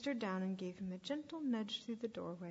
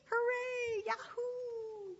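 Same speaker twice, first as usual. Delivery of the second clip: gleeful high whooping; jubilant cheering; 13 semitones higher, much slower than usual. Acted. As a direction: holler it freely into the hills. Runaway sheep celebrates escape.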